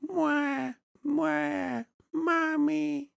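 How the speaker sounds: put-on very high cooing baby. Farmer in a bonnet acting a baby, very high cooing kisses. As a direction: coo like a baby blowing kisses. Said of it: "I look like a little baby".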